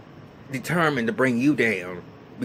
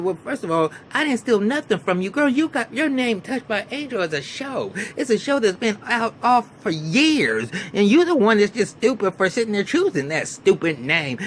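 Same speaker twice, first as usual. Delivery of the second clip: imitating a heated tirade; 8 semitones higher, 3 dB louder than usual. Retold argument, Maya's long retort.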